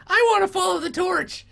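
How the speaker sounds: very high, squeaky goblin; another goblin. Acted. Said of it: "one of the other goblins".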